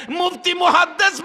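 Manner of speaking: high, loud preacher's warning; forceful warning. Real, not acted. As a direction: ring out the titles as a warning. Preacher warns even religious scholars.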